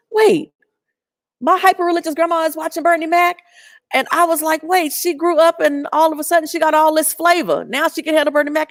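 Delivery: high, fast, incredulous; you can't believe what grandma's watching; high-pitched retold astonishment; amazed disbelief. Retold shock at grandma's show.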